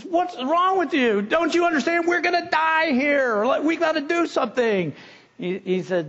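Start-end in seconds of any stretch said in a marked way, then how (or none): 0.0-4.9 higher, mock-frantic pleading; panicked castaway. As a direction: shake the calm friend in a panic. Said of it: "guy says".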